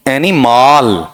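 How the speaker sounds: एनिमल stretched into "eni-MAAL"; एनिमल very slow, as "eni-maal"; deliberate mispronunciation. Shown as a demonstration. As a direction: drag out a long aa at the end of एनिमल. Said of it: "इसका उच्चारण करके बताना".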